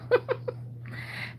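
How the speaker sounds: light amused laugh. Real, not acted. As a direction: laugh at yourself. Laugh at own joke.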